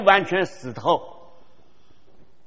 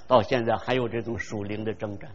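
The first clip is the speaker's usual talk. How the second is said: ordinary talk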